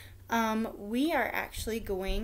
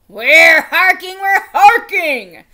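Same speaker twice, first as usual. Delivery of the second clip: louder, higher, slow exasperated reply; impatient king; 5 semitones higher, 16 dB louder, slower than usual. A narrator's reading. King answering the noisy crier, loud and slow. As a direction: wave the crier on, tired of the fuss. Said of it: "said the king".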